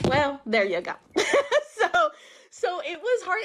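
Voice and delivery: rueful laugh through the words. Real, not acted. Laughing at her own bad luck, amused. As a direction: break into a high laugh as you finish.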